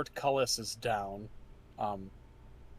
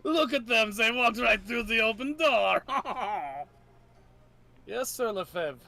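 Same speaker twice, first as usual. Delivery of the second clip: mock the captured heroes; villain knights' voices; 8 semitones higher than usual. GM voices Lefebvre and Bruno.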